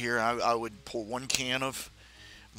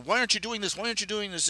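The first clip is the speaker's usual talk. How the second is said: much higher, pleading frustrated question; exasperated self-scolding; 9 semitones higher, much faster than usual. Retold or imitated. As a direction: scold yourself in rising exasperation. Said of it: "you're going".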